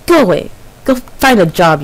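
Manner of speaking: usual speaking voice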